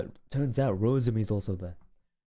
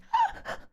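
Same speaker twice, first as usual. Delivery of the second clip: high, sharp gasp of horror; shocked gasp. Retold or imitated. Rosemi seeing Uki shoot Wilson, a high shocked gasp. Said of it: "Rosemi's like, gasps".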